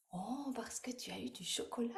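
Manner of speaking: very soft, near-whispered delight; softly encouraging; hushed reply. Real, not acted. Relays child's softly given answer.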